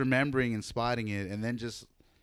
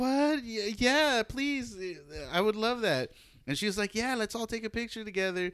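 higher, breathless delight; eager high voice; 9 semitones higher than usual. Retold or imitated. Cousin accepting the photo offer, high and eager.

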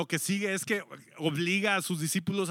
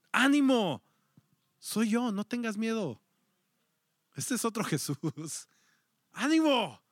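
reassuring call; 5 semitones higher than usual. Acted. Jesus calls across the water to calm his frightened disciples. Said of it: "Jesús se apresuró a consolarlos".

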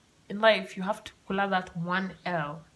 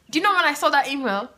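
excited; 7 semitones higher, 9 dB louder, faster than usual. Real, not acted. Excited storytelling.

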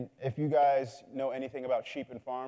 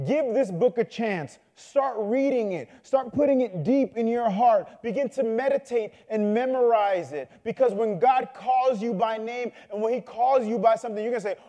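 raised, emphatic sermon exhortation; impassioned preaching; 6 semitones higher, 4 dB louder than usual. Real, not acted. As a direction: urge the congregation with rising intensity. Preacher urges the congregation.

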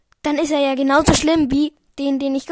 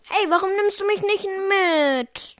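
mock whine; 6 semitones higher than usual. Chat pretends to be Harry, whining.

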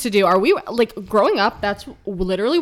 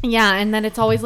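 ordinary talk, nothing marked

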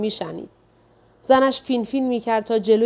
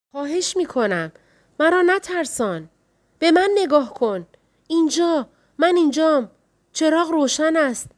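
higher, urgent wife begging him to look at her; a scared wife pleading, voice pitched up; frightened pleading; 6 semitones higher than usual. Acted. Wife begs her blind husband.

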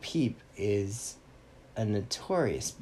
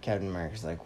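ordinary delivery, nothing marked

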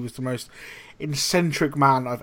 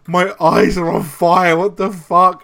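loud, raised mock-panicked yell; 3 semitones higher, 7 dB louder than usual. Young chef with chilli in his eyes, mock panic. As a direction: re-enact a panicked cry of pain, loudly.

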